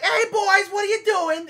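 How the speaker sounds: put-on high, loud take on the old Boston neighbor; Boston neighbor, imitated; imitating the neighbor. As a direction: greet the boys like a brash older lady. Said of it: "and be like".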